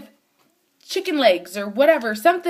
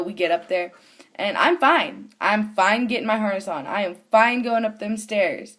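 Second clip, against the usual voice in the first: ordinary talk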